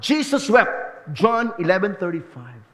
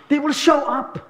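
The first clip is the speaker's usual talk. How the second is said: "They will show up" said higher, with the stress on "show"; raised repeat; 7 semitones higher than usual. Shown as a demonstration. Pastor repeating his promise to the congregation, pitched up. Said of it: "they will show up repeated".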